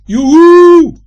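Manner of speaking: long, high, very loud holler; high drawn-out yoo-hoo call. A narrator's reading. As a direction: call at full volume into the distance. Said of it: "roept Joris luidkeels".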